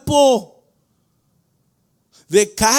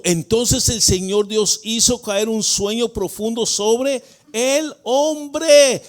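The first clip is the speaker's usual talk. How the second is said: normal talking voice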